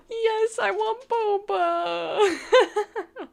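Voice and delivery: high, drawn-out New York wail; bad New York accent. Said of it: "also doing this, like, really bad New York accent".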